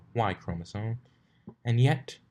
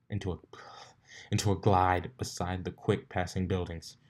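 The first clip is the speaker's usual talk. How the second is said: usual speaking voice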